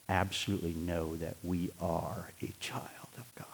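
unvoiced, soft whisper of certainty; whispered conviction. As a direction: breathe the words as a secret. Repeated, whispered intensely.

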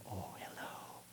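soft breathy whisper to a newborn; tender whisper. Retold or imitated. Grandfather greeting his first grandchild, whispering. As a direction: coo a hushed hello to the baby. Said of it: "he just melded down into a little".

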